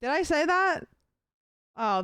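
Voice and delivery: ordinary delivery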